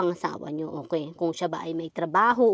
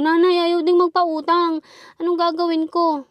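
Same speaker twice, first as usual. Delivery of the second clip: higher, louder whining plea; desperate kid pleading; 9 semitones higher, 6 dB louder than usual. Retold or imitated. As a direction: cry out to mom in a child's helpless voice. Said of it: "Sabi ko".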